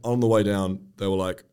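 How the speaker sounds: normal talking voice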